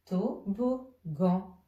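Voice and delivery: very slow, with gaps between syllables. Shown as a demonstration. Teacher piecing together the three syllables found, syllable by syllable. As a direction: set each syllable apart, one at a time.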